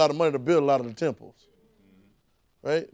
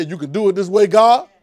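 praying demand; 4 semitones higher, 10 dB louder than usual. Retold or imitated. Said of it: "when I decide to say".

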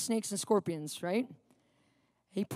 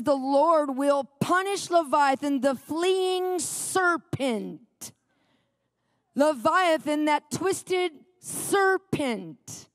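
dramatic scripture reading; 3 semitones higher, 3 dB louder, much slower than usual. Acted. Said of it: "it says".

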